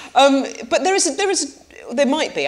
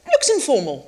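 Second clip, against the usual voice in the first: mock posh judge; 7 semitones higher than usual. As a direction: pronounce it like a TV judge. Mock posh judging of cake.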